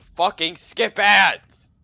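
very loud, slow, drawn-out yell; exasperated yell. Real, not acted. Streamer railing at ads on videos, exasperated yell. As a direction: bellow each word out long and high. Yelling about skipping ads.

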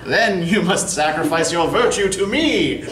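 lightly hammed-up melodrama villain; leering stage villain; mustache twirling villain. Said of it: "this dastardly villain with twirling mustaches".

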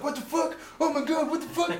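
very high, loud freak-out; mock panicked shock. Soldiers wake to find body bags, mock panicked shouting. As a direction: shriek it in comic horror.

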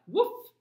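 high loud woof; dog bark. Acted. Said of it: "said Dog".

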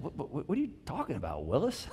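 hushed jokey catchphrase, a touch bewildered; mock bafflement. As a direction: lightly play a baffled Moses, sputtering softly. Moses baffled, sitcom catchphrase quoted.

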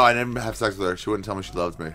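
ordinary talk

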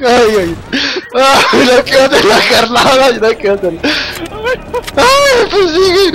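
frantic panicked yelling, high; scared screams while running away; being chased. Real, not acted. Perseguido por el monstruo en el juego. (gritos de pánico al ser perseguido)

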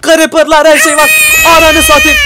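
high loud panicked cry; panicked shout. Real, not acted. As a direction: yell in terror. Overwhelmed by mobs.

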